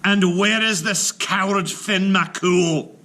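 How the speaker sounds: big slow booming giant call; giant's shout. Acted. Giant storming in demanding Finn, slow loud shout. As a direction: bellow it out slowly, a giant storming in. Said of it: "he came in shouting".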